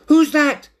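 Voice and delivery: high, loud startled call. A narrator's reading. Cat hears rustling behind a bush, calls out. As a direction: call sharply toward a hidden stranger. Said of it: "he called".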